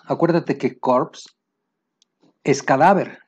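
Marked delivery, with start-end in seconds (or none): 0.8-1.3 "corpse" said with audible "ps" ending; sounded p and s. Shown as a demonstration. Teacher explains word for cadaver, "corpse" ending in clear ps. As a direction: sound both final consonants in "corpse". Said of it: "la pronunciación".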